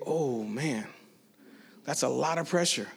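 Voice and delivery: aparte bajo y grave; agobio en voz baja. Retold or imitated. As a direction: dicho para sí, con apuro. Aside on pressure he felt. (aparte agobiado, en voz baja)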